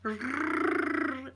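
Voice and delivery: trilled "Rrrr" demonstrating the rolled r. Shown as a demonstration. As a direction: roll the R long, as a teacher. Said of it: "We would go, "No".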